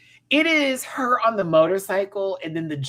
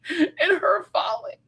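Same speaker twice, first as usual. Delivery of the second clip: laughing; 4 dB louder than usual. Real, not acted. Mocking the fake fall scene.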